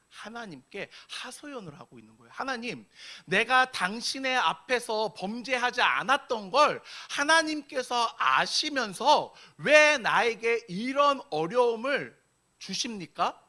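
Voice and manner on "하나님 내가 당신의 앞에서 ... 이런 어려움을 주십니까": higher-pitched, pressing complaint; protesting to God. Acted. Job pleading his case before God, protesting. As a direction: demand an answer, stretching out the grievance. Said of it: "따지는 거예요".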